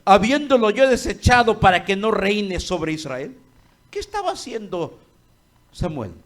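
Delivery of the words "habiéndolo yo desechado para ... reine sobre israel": God's voice, loud. Acted. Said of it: "dijo Jehová a Samuel".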